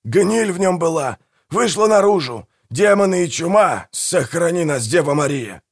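high-pitched, fervent proclamation; dramatic, agitated declaiming; proclaiming. A narrator's reading. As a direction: declare doom, then appeal to heaven. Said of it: "провозгласил Манфред".

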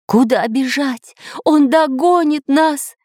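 high, drawn-out panicked cry; professor's stretched, high-pitched cry. A narrator's reading. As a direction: wail it high in alarm. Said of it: "кричал профессор Пыхтелкин".

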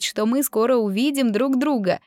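plain reading voice

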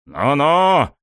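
high, loud mocking warning; taunting. A narrator's reading. Coach to departing student, taunting.

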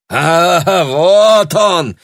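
high, loud hearty shout; exclaiming loudly. A narrator's reading. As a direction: announce him with gusto. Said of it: "вскричал Дэвис".

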